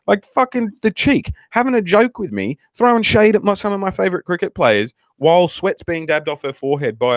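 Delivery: high-pitched, incredulous outrage; comically affronted, pitch shooting up; mock indignation. Mock outrage at doctor's cheek.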